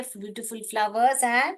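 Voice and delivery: ordinary talk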